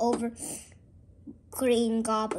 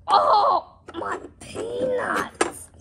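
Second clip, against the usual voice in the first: high, loud, drawn-out comic wail; mock pain cry; 12 semitones higher, 5 dB louder, much slower than usual. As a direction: wail as if hit somewhere painful. Figure hurt in play fight.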